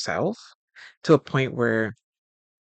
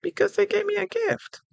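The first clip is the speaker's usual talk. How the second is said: high innocent reply. Retold or imitated.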